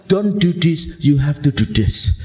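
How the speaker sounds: a hint of a stern mother ordering; lightly voiced scolding mother; mild mock mom giving orders; bossy mom. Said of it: "dalam konteks".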